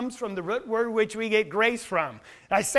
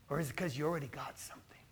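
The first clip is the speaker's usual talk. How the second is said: whisper; 7 semitones lower, 13 dB quieter, faster than usual. Acted. Hushed rhetorical aside.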